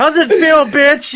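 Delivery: loud, high taunt; hammed-up victorious shout; gloating yell. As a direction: jeer it at a beaten enemy. Acted gloating cheer at captured bug.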